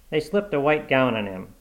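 plain reading voice

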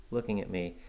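usual speaking voice

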